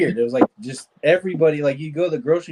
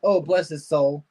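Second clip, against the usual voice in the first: sweet Southern lady. Retold or imitated. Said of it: "They always sound nice".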